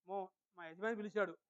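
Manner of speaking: low, soft, deferential murmur; lightly voiced obedient wife, softly; submissive wife. Wife hearing her husband call, submissive and soft. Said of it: "తన భర్తకి లోబట్టు ఎలాగంటే".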